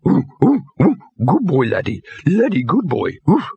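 high, eager simple-dog voice; barked. A narrator's reading. Simple dog answers his trainer's call, barking eagerly. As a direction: yip the words like an excitable dog. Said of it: "barked Laddie".

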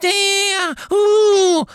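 very high, loud, slow sputtering squeal; Dobby breaking off in distress; stammering elf. A narrator's reading. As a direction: choke on the words in horror.

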